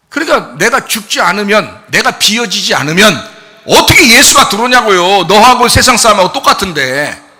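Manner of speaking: loud, high, urgent sermon delivery; full-force preaching. Real, not acted. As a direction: drive each condition home at the top of the voice.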